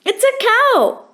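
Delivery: high, delighted cry of recognition; joyful cry. A narrator's reading. Archie hears the cow, cries out with joy. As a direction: greet it like an old friend.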